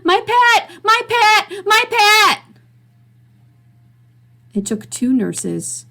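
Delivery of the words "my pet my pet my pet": much higher, much louder screams; frantic repeated scream. Acted. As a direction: shriek it over and over. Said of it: "Mister Andrews was screaming".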